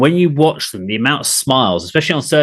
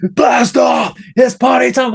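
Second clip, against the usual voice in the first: a higher, louder imitation of the band's shout; mock band shout; 10 semitones higher, 4 dB louder, slower than usual. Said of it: "And there's like".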